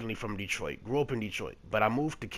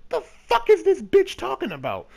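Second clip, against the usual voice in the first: much higher and louder, disbelieving; baffled outburst; 10 dB louder than usual. Retold or imitated. Reacting to the wild accusation, loud high stunned disbelief.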